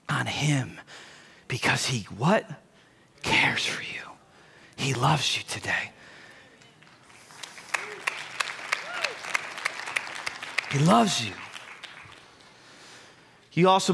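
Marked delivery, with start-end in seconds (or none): 0.1-2.4 whispered softly. Acted. Pastor whispering about casting anxiety on God. Hushed sermon climax. 3.2-11.3 soft, low and whispered; tender hush. Acted. Pastor tenderly reassuring listeners that God loves them.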